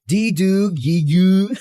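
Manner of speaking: put-on teasing baby babble; mock baby gurgle; lightly voiced baby talk, laughing. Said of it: "Sounds like a baby trying to talk".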